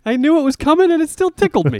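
high, fast, laughing through the words; cracking up mid-sentence. Real, not acted. Cracking up at the accent.

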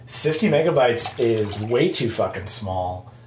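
ordinary talk

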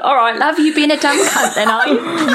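mock British. Co-host riffing on the stranger's lines, mock British accent. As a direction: parody a British rogue, amused. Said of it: "they all say love".

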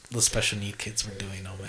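hushed unvoiced aside; a sneaky whispered aside. Real, not acted. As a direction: whisper it so nobody hears. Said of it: "whispered aside".

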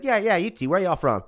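high, loud eager local voice; big local guy. Retold or imitated. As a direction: insist cheerfully, pointing them out. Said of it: "He's like".

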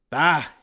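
high, loud villain cry. A narrator's reading. Dracula's bone face appears to the heroes, loud cry. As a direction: burst out with a roaring cry. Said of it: "he cried".

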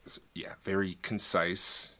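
ordinary talk

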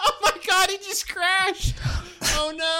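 high laughing cry of shock; gasping through laughter. Real, not acted. Paul Walker crashes again.

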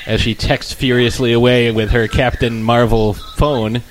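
ordinary talk

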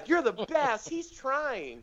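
very high cooing praise; mock encouragement. Classmates praise a struggling beginner comic, mock supportive. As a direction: imitate gushing, patronizing support. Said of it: "everybody has to clap for whatever you say".

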